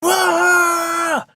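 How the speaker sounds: character Thunder's swelling roar; imitated roar of swelling. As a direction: belt out a cartoon roar as he swells. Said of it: "he's going".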